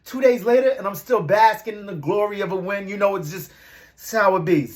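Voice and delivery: usual speaking voice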